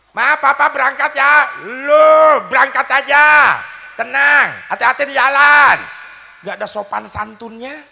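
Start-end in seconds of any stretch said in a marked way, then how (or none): 0.1-1.7 loud, high call across the house; husband yelling goodbye from the yard; shouting from outside. Acted. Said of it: "Sambil teriak suaminya". 1.7-5.8 loud, high, slow yell from the kitchen; wife shouting from the kitchen, not coming out; yelling back. Acted.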